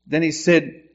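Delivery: ordinary talk, nothing marked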